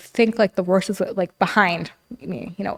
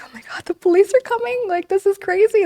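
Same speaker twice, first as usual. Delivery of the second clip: high, panicked and a bit louder; retold panic; 12 semitones higher, 4 dB louder than usual. Panicking daughter as police arrive, high frantic voice. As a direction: squeal it out like the police are pulling up. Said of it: "I'm like".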